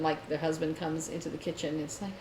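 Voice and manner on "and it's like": ordinary delivery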